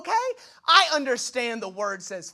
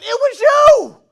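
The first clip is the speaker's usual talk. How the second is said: much higher, much louder accusing yell; accusing shout; 13 semitones higher, 15 dB louder, much slower than usual. Pretend. Sailors on the boat catching Jonah, accusing yell. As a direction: point at him and yell.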